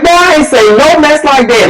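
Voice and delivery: high-pitched, offended retort; indignant denial. Retold or imitated. Girl denies what the boy claims she said, indignant.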